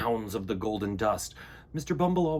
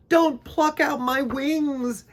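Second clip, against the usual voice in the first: high, loud, slow begging; terrified bee begging his captor; 12 semitones higher, 8 dB louder, slower than usual. A narrator's reading. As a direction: plead for your wings. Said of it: "he begged".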